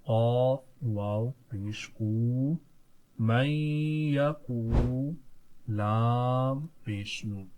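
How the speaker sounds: each letter drawn out, slow; slow teacher's spelling; slow spelling drill. Shown as a demonstration. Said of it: "किस तरह?".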